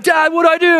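high-pitched, frantic cry for help; panicked plea. Retold or imitated. Son phoning his dad about a snake, high panicked plea. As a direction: beg in a hammed-up panic.

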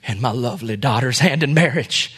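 low, soft, mock-tearful plea; understated sorrowful old man. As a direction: plead quietly, as if close to tears. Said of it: "with tears in his eyes".

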